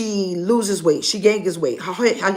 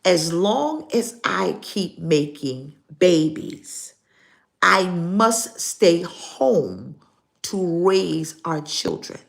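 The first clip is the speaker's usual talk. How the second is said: slow, deliberate quoting; slow repeat; slower than usual. Retold or imitated. Pastor repeating the stranger's words, slow and deliberate. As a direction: spell out each word slowly. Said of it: "She said".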